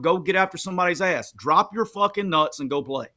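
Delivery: ordinary talk, nothing marked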